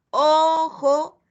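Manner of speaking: "Ojo" rejoined from its syllables; blending syllables. Shown as a demonstration. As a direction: blend "Ojo" back together. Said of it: "volvemos a formar la sílaba". ("Ojo" vuelto a unir)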